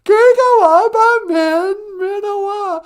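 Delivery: very high, loud, stretched-out character voice; squeaky pupil repeating. Acted. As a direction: parrot the phrase slowly in a piercing puppet squeal. Sidekick repeats the taught phrase.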